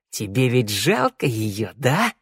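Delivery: slow, needling question; mock pity. A narrator's reading. Shnyryala taunts a hidden listener, mock pity. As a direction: draw it out as a mild taunt.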